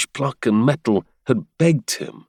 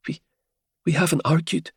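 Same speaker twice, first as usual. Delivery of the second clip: higher female voice; 8 semitones higher, 3 dB quieter than usual. Acted. Helia shivering, stammering.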